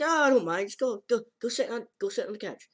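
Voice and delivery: put-on high, loud, insistent girl voice; high, quick cheerful insistence; pushy girl voice. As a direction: hurry him to the couch.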